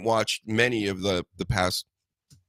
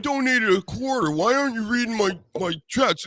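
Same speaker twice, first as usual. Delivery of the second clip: whiny viewer; 11 semitones higher than usual. Retold or imitated. Mocking a whiny chat viewer.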